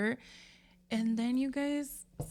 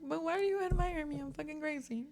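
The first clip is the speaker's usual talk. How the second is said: mock disbelief; 7 semitones higher than usual. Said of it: "And I'm like".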